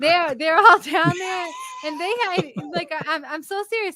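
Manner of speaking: high, laughing through the words; laughing while talking. Real, not acted. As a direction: giggle through the story.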